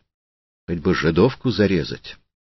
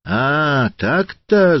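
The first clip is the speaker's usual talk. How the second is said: high, rising narrated yell; 9 semitones higher, 4 dB louder, much slower than usual. A narrator's reading. Hooligan turns on the widow at the gate, yelling. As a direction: let it leap up high into a loud yell. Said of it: "завопил хулиган".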